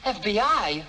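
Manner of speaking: loud alarmed echo; startled. Acted.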